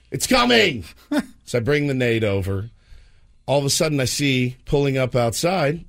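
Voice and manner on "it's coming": high-pitched harried call; exasperated dad yell. Retold or imitated. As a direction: call back to a pestering kid. Harried dad answering nagging child.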